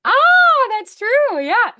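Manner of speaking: high, loud burst of surprise; delighted realization. Real, not acted. Coach reacting to student's mention of her husband, delighted realization. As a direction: exclaim it high and loud, suddenly remembering.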